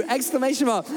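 usual speaking voice

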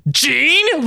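high-pitched astonished mock scream; acted confused shout; screaming the name. A narrator's reading. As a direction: cry the name out in disbelief. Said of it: "Logan screamed at Jean".

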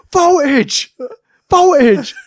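high, loud call; shouting a name. Retold or imitated. Wrestler shouting for his partner from Gorilla, loud call. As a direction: call him across backstage. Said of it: "and he shouts".

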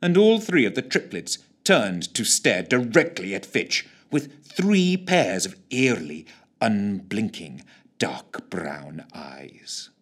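usual speaking voice

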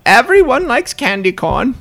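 put-on high, loud old lady coo; granny voice. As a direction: sweet-talk like a creepy grandma. Voicing granny stripper.

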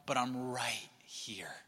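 slow, breathy whisper of comfort; hushed and slow; whispered reassurance. Acted. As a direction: whisper it close, like a promise. Comforting God's voice to listener.

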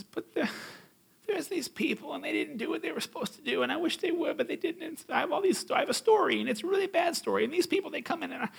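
high, whiny complaining voice; mock whining. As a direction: whine like a self-pitying victim. Mocking the whining man.

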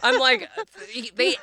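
normal talking voice

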